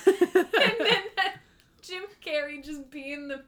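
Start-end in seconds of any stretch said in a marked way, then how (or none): 0.0-1.4 laughing. Real, not acted. Laughing over the tablecloth story.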